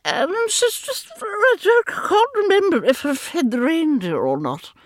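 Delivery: one brandy too many, muddled; mildly tipsy festive granny; tipsy Mrs Christmas. Acted. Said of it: "a sozzle Missus Christmas, who's had a little bit too much brandy".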